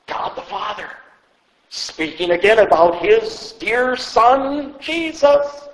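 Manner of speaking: ordinary talk, nothing marked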